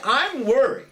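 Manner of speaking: ordinary delivery, nothing marked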